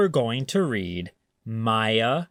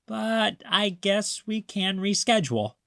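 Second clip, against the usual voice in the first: higher, teasing grandma voice; Grandma's playful voice; 8 semitones higher than usual. A narrator's reading. Grandma coaxing grumpy Maya, high and teasing. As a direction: sell the silly idea like a sly grandma.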